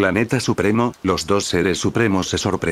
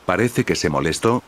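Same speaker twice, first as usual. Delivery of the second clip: usual speaking voice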